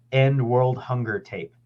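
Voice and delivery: normal talking voice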